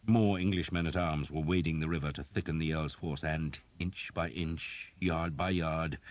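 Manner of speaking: usual speaking voice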